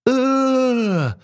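long high scream of pain; high yell of pain. A narrator's reading. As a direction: yell out as if bitten. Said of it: "заорал укушенный".

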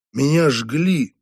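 ordinary delivery, nothing marked

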